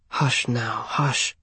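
soft, gentle hushing; calm him softly; soothing hush. A narrator's reading. Mother soothing crying son.

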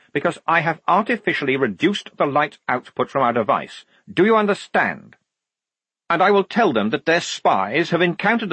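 high, insistent officer asserting authority; exasperated dressing-down. Acted. Lieutenant overruling his sergeant, exasperated and commanding. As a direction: press each question harder on the sergeant.

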